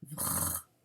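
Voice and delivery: vr breathed out unvoiced and soft; whispered vr. Shown as a demonstration. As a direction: whisper vr to yourself while writing. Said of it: "j'ai oublié de finir mon V".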